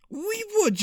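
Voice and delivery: croaking voice. A narrator's reading. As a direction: croak it out, nervous and queasy. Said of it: "had a croaking voice".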